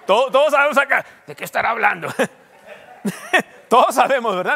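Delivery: loud, laughing tease. Real, not acted. Pastor teasing the congregation about weight. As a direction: laugh it out at the crowd.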